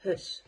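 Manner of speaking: "hus" said with a short u in place of the ou diphthong; South African house. Shown as a demonstration. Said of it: "the South African English say it as".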